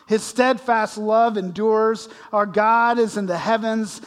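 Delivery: exultant worship cry, slow and loud; psalm praise. Retold or imitated. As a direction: lift it up like singing a psalm. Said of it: "they would have said something like this".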